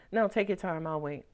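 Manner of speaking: ordinary talk, nothing marked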